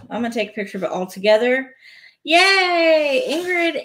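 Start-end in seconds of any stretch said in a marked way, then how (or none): 2.2-3.8 excited cheer. Real, not acted. Cheers for Ingrid on Twitch.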